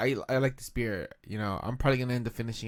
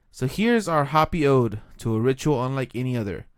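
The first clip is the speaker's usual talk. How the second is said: dramatic narration; 5 dB louder than usual. Acted. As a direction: read it like an epic. Reads beer label dramatically.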